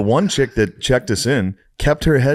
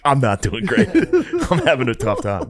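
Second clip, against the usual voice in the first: laughing admission; 4 semitones higher than usual. Retold or imitated. Admitting he is not okay.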